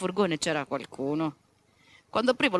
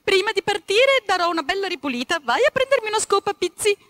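high, loud scolding wife; wife scolding about the dirty van; woman's voice; 11 semitones higher, 7 dB louder than usual. Acted. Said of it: "aggiunse rivolta a un ragazzino".

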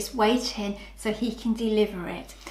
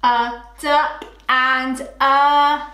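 slow, word by word; slow reading back; 2 semitones higher, 4 dB louder, slower than usual. Shown as a demonstration. Teacher to class, slow read-back of the sentence. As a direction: read it back slowly, pointing. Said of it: "reading back slowly".

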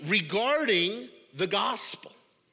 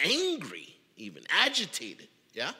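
ordinary delivery

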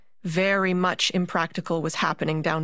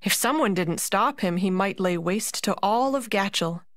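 plain reading voice

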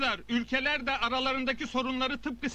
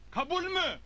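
rallying shout; 12 semitones higher than usual. Acted. Rallying the crowd.

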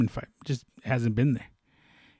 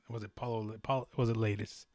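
ordinary talk

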